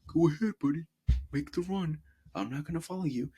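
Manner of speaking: high, teasing voice letting him run; mock Rudiger. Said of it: "Rudiger was like".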